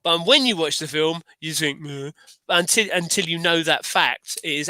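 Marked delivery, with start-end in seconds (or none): 1.8-2.1 unimpressed meh. Pretend. Viewer's unimpressed reaction.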